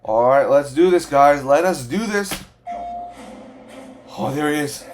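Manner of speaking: ordinary talk, nothing marked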